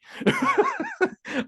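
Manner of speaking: a burst of high laughter; high laughing fit. Real, not acted. Laughing at the irony of missing the pizza, high laughing fit.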